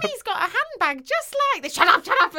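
put-on high, posh little child. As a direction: sound like a small girl.

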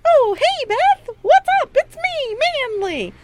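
cheerful as if nothing happened; breezy mock Manly. Host mocking Manly's carefree hello in a high falsetto. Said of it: "he's just like".

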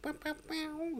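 high sung guitar bend; guitar bow imitation. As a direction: mimic a soaring guitar note. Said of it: "the guitar doing that sort of".

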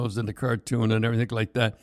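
normal talking voice

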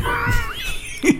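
high shrieking laugh. Real, not acted. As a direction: burst out laughing. Laughs at quiz joke.

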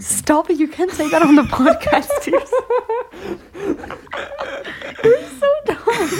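loud, high scolding through laughter; laughing scold. Real, not acted. Host laughing as she scolds the guest's slavery joke. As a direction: scold him while cracking up.

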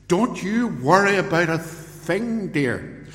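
high, booming mock reassurance to a wife; hammed-up loud husband, slow and carrying far too well; imitating loud Englishman. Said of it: "He had this loud English accent".

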